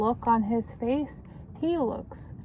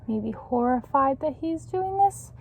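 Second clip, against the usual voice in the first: ordinary delivery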